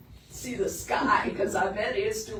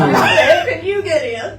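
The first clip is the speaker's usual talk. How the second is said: exasperated yell; 7 semitones higher, 16 dB louder than usual. Retold or imitated. Exasperated at dog getting in.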